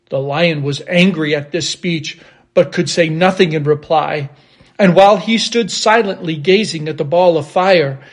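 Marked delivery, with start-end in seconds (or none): none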